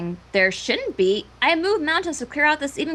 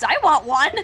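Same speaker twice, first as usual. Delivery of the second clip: normal talking voice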